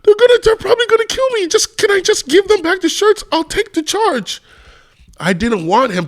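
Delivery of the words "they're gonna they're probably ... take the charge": very high mock-desperate begging; put-on panicky wail, stumbling over the words; acting move. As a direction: plead for your life in a hammed-up falsetto. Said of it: "like, acting move".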